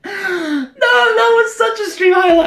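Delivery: very high, shouted, half-crying joy; overjoyed streamer screaming at a photo finish; ecstatic shouting. Real, not acted. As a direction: yell it out in pure disbelief. Still celebrating the finish.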